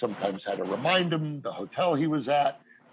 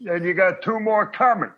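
higher, pitched-up Ray voice through a laugh; Ray needling Peter, higher and cracking up; Ray Romano impression; 7 semitones higher, 4 dB louder than usual. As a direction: do Ray echoing the punchline, amused. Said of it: "And Ray goes".

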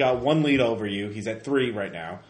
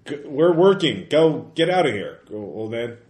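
raised, higher voice shooing someone off; gruff dismissal; 4 semitones higher, 8 dB louder than usual. Acted. Worker shooing a reporter away, loud dismissal. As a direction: tell an intruder to clear out, loudly. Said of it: "He's like".

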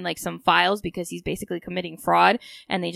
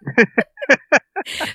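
loud laugh; 7 semitones higher, 8 dB louder than usual. Real, not acted. Laughing mid story.

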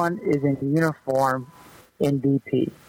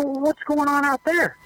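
much higher, bewildered retold question; confused rookie; 11 semitones higher than usual. Rookie asking the manager about the field, much higher voice. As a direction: ask in confusion, pitched well up. Retold locker room exchange.

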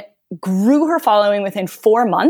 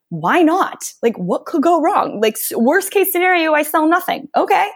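carefree, unbothered; 7 semitones higher than usual. Retold or imitated. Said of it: "She was just like".

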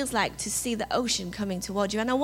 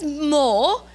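loud, outraged rising question; theatrical English indignation; indignant man from Oliver; 2 semitones higher, 6 dB louder than usual. Acted. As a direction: act out the appalled master from Oliver. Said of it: "the man turns around and says".

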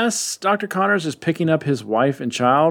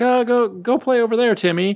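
much higher put-on mother's voice; mother shooing child; 11 semitones higher than usual. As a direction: send the kid off to play, pitched high.

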